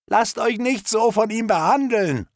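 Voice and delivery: put-on high, urgent young voice; pleading captive. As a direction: urge the others on, voice pitched high. Captive redhead urges the others. (hohe, eindringliche Stimme einer Gefangenen)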